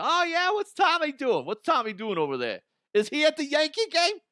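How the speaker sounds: high mocking squeak of a clueless onlooker; high silly voice asking what Tommy's doing.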